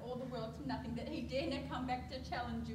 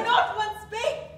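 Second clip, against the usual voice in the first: loud protest; 8 semitones higher, 16 dB louder, slower than usual. Acted. Nurse protests.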